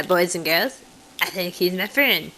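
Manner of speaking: ordinary talk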